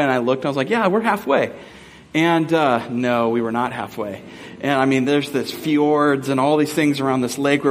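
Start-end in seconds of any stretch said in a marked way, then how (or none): 0.7-1.5 high-pitched, mock-assured agreement; breezy confidence. Hiker wrongly agreeing they are halfway, breezy confidence. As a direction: chirp it with hammed-up optimism.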